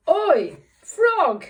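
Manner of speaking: loud, high cross shout; angry cat voice. Acted. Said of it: "your angry cat voice".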